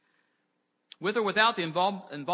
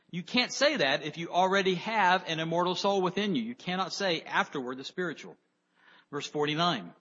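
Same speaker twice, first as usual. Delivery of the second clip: ordinary talk, nothing marked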